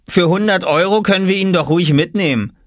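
put-on high boyish voice; teasing brother. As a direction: propose it like a cheeky kid. Brother teases with a proposal. (freche, hohe Jungenstimme)

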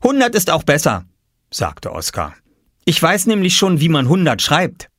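put-on high proud child voice; a little boy boasting he can write numbers; young boy. A narrator's reading. Oskar proud of his writing.

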